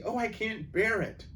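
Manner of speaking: put-on a girl who can't stand the pulling; frightened plea; distressed. Lucy dragged by magic.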